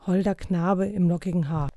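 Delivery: ordinary delivery, nothing marked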